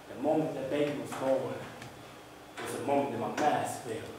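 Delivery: hushed, subdued confession; very soft stage storytelling; spoken-word performance. Acted. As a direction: confide it quietly, pausing between lines.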